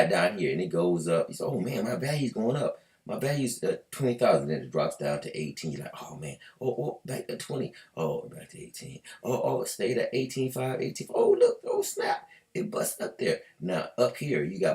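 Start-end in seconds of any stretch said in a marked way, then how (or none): 1.4-11.1 mock excited trader, voice jumping high then sinking; playful imitation of a nervous trader watching the price. 11.1-12.3 mock squeal, very high and louder, drawn out; hammed-up high yelp of surprise; surprised. Price suddenly jumps. 12.5-13.4 mock excited trader, voice jumping high then sinking; playful imitation of a nervous trader watching the price.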